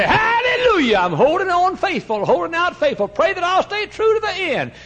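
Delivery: mock hallelujah shouting, very high and loud; showy shouted testimony. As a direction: put on a loud church show of piety. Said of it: "You put on a show".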